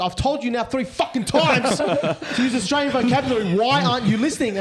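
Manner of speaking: half-joking scolding of a chatbot; mock angry yell at laptop. Telling off his laptop's AI, laughing mock yell. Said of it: "J P screaming at his laptop".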